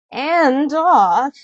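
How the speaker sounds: high, slow whiny stepsister; a spoiled stepsister piling on chores; high and drawn-out bratty sister. Acted. Stepsister voiced.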